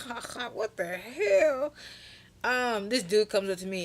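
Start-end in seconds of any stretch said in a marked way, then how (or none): 0.1-1.7 imitated laugh rolling into higher-pitched words; mock laughing. Teller laughing inside at his name, mock laughing disbelief. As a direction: act out an amused, baffled laugh. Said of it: "As I'm laughing".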